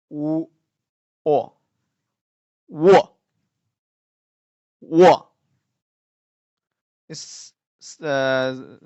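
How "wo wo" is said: "Wo" as u gliding into o, loud; u and o blended. Shown as a demonstration. Teacher models a combined final for learners, blended loud and clear. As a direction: say "Wo" as one forceful syllable, u into o. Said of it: "is the u plus o".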